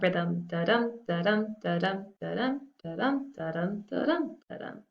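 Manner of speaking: teacher tapping out iambic da-dum, light on da, stressed dum; da-dum rhythm. Shown as a demonstration. Teacher demonstrating meter to students, chanting da-dum. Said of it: "when you hear in a poem that".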